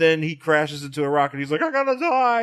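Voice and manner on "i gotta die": high-pitched mock anguish; over-the-top high wail; hammed-up desperate cry. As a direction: cry out like a melodramatic doomed hero. Acting out hero crashing to die.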